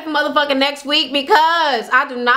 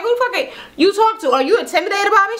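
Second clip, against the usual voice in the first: mock confrontation; 7 semitones higher, faster than usual. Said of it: "She was like".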